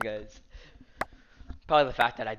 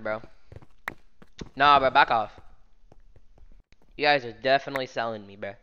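ordinary delivery

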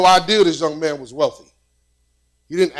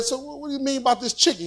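baffled question; 7 semitones higher, 8 dB quieter, faster than usual. Retold or imitated. His baffled question to the prince.

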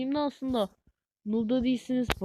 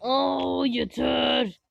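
fed-up outburst; 4 semitones higher, 4 dB louder, much slower than usual. Real, not acted. Frustrated he cannot die.

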